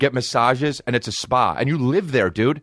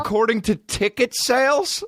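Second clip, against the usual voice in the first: incredulous; 7 semitones higher than usual. Retold or imitated. Mocking the reporter's phrase.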